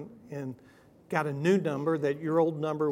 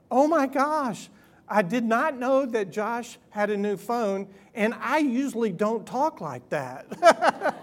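mock embarrassed gasp; 5 semitones higher, 5 dB louder, slower than usual. Embarrassed aunt's text retold.

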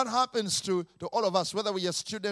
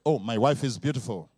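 usual speaking voice

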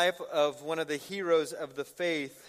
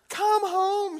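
put-on high, loud pleading kid voice; mock panic; 7 dB louder than usual. Daughter begs dad to come home, mock-panicked plea. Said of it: "And she's panicked".

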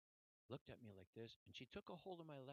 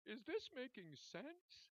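high, slow tearful question; slow, pitched-up imitation of tears; imitated crying; 10 semitones higher, 3 dB louder, much slower than usual. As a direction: ask it as the weeping nurse, drawn out. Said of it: "she's crying now".

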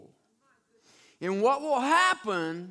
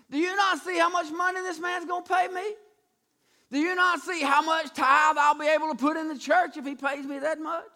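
incredulous pleading; 6 semitones higher than usual. Acted. Balaam begging God over the money, high pleading.

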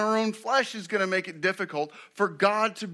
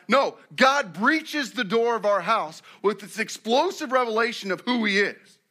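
usual speaking voice

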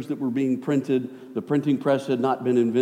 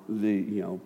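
normal talking voice

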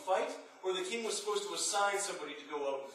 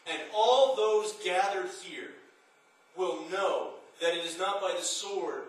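louder, declaiming battle cry; preaching at full force; 4 dB louder than usual. Acted. Preacher thundering David's challenge to Goliath. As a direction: proclaim it like a sermon's climax.

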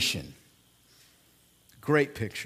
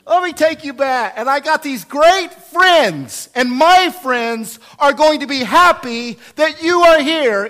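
high, loud, beaming voice; Jesus gleefully bringing the sinner home; joyful Jesus; 7 semitones higher, 9 dB louder than usual. Acted. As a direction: pour out the welcome, brimming with joy. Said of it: "Jesus voice continues".

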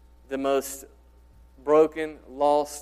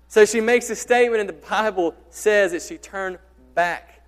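loud, higher, driving preacher voice; preaching at full force; 4 semitones higher, 9 dB louder than usual. Acted. Preacher challenging the congregation about Jesus, loud and driving. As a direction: hammer it home to the congregation.

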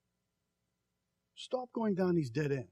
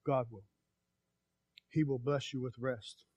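ordinary talk, nothing marked

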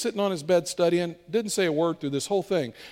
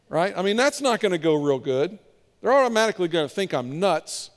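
ordinary talk, nothing marked

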